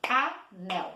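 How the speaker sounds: "Anel" split in two, A, NEL; two distinct beats in "Anel"; syllable by syllable. Shown as a demonstration. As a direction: say "Anel" with a gap between syllables. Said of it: "Bati duas mãozinhas também".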